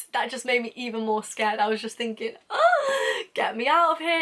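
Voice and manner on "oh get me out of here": high, loud dramatic groan of dread; mock panic. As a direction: wail it like you're desperate to escape. Retelling her panicked inner thought.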